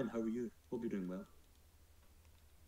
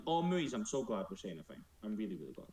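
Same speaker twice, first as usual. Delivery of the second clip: normal talking voice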